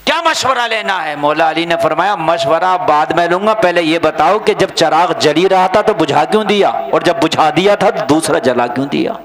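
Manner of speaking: loud, insistent sermon dialogue; forceful preaching. Real, not acted. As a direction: fire the questions out to the crowd.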